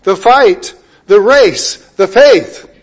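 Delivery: "fight", "race", "faith" each punched loud and high; stress on the nouns. Shown as a demonstration. Preacher showing where the Greek puts emphasis, punched nouns. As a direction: hammer out "fight", "race", "faith" one by one, with pauses. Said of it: "the emphasis is on".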